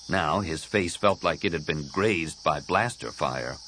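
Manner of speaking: usual speaking voice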